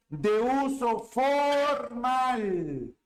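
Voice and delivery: drawn-out "De uso formal", every word stretched; slow dictation. Shown as a demonstration. Teacher reading the slide aloud, dictating slowly. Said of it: "¿qué cosa dice?".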